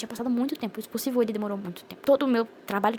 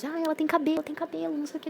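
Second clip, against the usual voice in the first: excited exclamation; 7 semitones higher than usual. Retold or imitated. Doctors excitedly see the hair.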